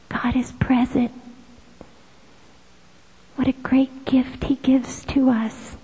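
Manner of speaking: hushed, reverent whisper; whispered devotion; prayerful whisper. Acted. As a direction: breathe it out in awe.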